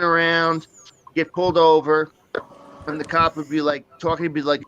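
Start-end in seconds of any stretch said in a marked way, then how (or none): none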